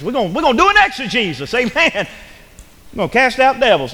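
high, loud mock-eager country boy. Preacher plays a country boy misreading exegesis as exorcism, hammed-up excitement. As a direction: whoop it up in mistaken excitement. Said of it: "I'm a country boy".

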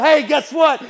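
loud, high; telling old friends he's found the answer, excited; excited announcement. Retold or imitated. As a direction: call out the good news to your old friends. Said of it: "I just wanted to tell them".

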